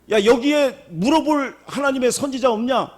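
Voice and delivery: high, urgent king asking for a prophet; a king pleading for any prophet, loud and high; desperate king calling. Retold or imitated. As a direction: call out like a king in trouble. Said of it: "그렇게 얘기했더니".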